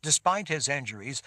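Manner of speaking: usual speaking voice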